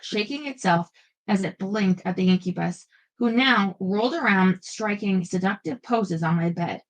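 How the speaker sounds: ordinary delivery, nothing marked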